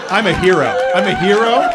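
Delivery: loud, gloating victory cry; triumphant boast. Pretend. Comic gloating to the crowd after crushing heckler, loud triumph. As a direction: crow over the beaten heckler.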